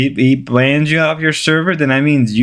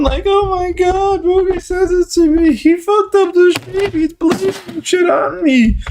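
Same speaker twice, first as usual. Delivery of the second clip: high-pitched mocking whine, rising ever higher; whiny mockery; 11 semitones higher than usual. Streamer mocking Rastov's complaints in a high whine. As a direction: squeal it like a crybaby complaining.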